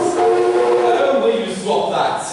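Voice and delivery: high, loud exasperated cry. Real, not acted. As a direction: snap at him in exasperation. Annoyed at butler's interruption.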